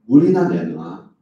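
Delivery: loud, slow, curt demand; a sulky people ordering God for water. Acted. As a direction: bark the order slowly.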